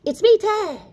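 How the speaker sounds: put-on high, loud child's call; a little one calling to his dad for attention; excited little tadpole. Tad shows off to his dad, high and loud.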